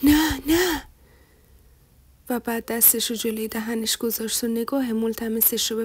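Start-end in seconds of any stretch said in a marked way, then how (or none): 0.0-0.8 higher, louder cry of refusal; crazed cry. A narrator's reading. Son refusing his mother's suggestion, crying out frantically. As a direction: cry out in frantic protest, short of a full scream. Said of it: "مثل دیوونه ها فریاد کشید".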